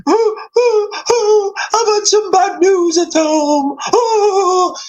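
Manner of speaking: hammed-up blubbering, mocking a tearful leader; mock crying David Cameron. Ridiculing a crying prime minister to his viewers, mock sob voice. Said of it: "David Cameron sat there crying".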